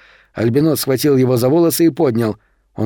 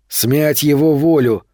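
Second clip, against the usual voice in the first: usual speaking voice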